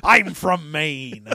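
slow mock pirate with a Maine accent; a pirate Ahab from Maine, slowly. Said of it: "talking like a person from Maine".

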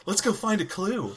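mock Hardy Boys adventurer; Hardy Boys sleuth. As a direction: play an earnest boy detective, drawn out. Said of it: "That was like a Hardy Boys thing".

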